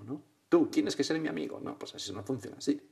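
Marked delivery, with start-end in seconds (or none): 0.5-1.6 higher-pitched insistent mock demand. Imagined person demanding friendship from another, mock demanding. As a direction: demand it, in a pretend way. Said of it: "No, no exigirlo".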